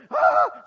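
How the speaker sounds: loud, high shriek at the needle; imitated wail of pain; child's pain scream. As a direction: yelp out like a jabbed toddler. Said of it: "Jarum kedua".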